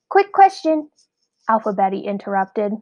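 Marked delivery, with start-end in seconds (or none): 0.1-0.9 eager interruption. A narrator's reading.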